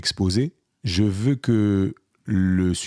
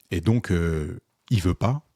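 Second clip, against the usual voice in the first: usual speaking voice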